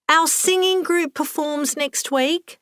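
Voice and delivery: high-pitched, drawn-out call across a room; mild shout. A narrator's reading. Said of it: "Lucy shouted".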